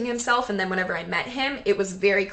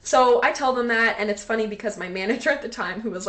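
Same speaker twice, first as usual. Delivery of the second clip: normal talking voice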